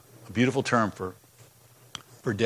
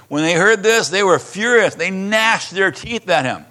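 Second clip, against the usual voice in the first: higher, louder dramatic telling; dramatic reading; 6 semitones higher, 4 dB louder, slower than usual. Acted. Pastor tells the crowd's fury to his congregation, dramatic. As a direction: build the crowd's fury as you read.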